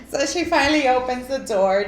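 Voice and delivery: ordinary talk, nothing marked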